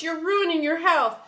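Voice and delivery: high, loud pleading; pleading cry. Acted. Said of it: "cried George".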